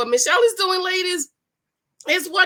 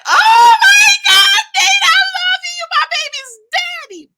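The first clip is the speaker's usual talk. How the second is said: swooning scream; 13 dB louder than usual. Retold or imitated. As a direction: scream like a fan who has just seen her crush. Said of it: "I was like".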